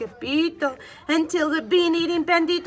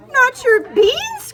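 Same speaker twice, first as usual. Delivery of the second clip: high, loud outraged cry; slow, high and loud, outraged; 10 semitones higher, 9 dB louder, slower than usual. A narrator's reading. Skipito hears the bandito steals the beans, crying out outraged. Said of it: "cried Skipito, outraged".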